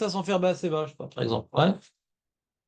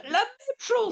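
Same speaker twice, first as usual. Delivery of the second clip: very high-pitched, exasperated conclusion; high, insistent exclamation; exasperated insistence; 3 dB quieter, slower than usual. Real, not acted. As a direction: drive the point home with the voice shooting up. Exasperated conclusion after repeated explanation.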